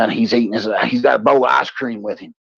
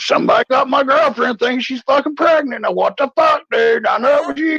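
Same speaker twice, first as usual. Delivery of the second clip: accusing friend; 13 semitones higher than usual. Retold or imitated. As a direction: accuse your buddy.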